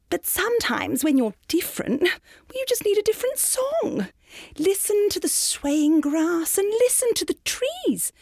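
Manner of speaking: high, kindly little cricket; gentle cricket voice. A narrator's reading. Kindly cricket advises Gerald.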